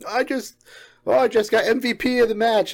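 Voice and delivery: high, excited teammate voice, mildly hammed; bragging kid, pitched well up. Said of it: "they were like".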